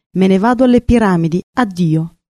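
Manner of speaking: ordinary delivery, nothing marked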